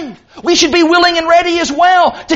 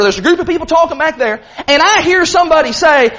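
normal talking voice

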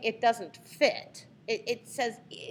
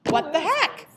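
higher, louder, incredulous protest; acted indignation; 6 semitones higher, 7 dB louder than usual. David confronting the army over Goliath's taunts, indignant. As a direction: demand an answer, voice raised and pitched up.

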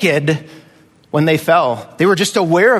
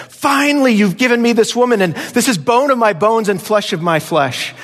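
overjoyed Adam; 7 semitones higher than usual. Retold or imitated. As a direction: burst out "finally," like a man overwhelmed with joy. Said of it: "Adam says".

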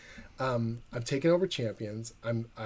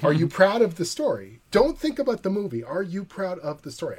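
higher, louder, pressing question; earnest mentor; 7 semitones higher, 5 dB louder than usual. Retold or imitated. Mentor pushing the writer to own his story, earnest. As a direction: challenge him kindly but firmly. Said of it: "And he goes".

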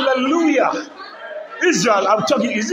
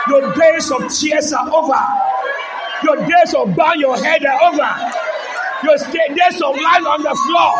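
raised, higher declaring shout; loud prophetic exhortation to the church; full-force preaching; 4 semitones higher, 3 dB louder than usual. Acted. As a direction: build it into a triumphant proclamation.

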